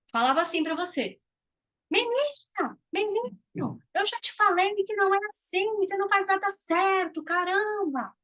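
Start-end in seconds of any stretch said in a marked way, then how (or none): 1.9-8.1 put-on high, sharp scolding of a child; higher, fast mock scolding parent. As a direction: scold like an impatient mother. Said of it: "Olha o tom de voz".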